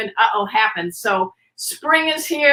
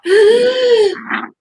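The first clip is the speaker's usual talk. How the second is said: high loud choking gasp; 11 semitones higher, 6 dB louder than usual. Shown as a demonstration. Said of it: "that cough sounds".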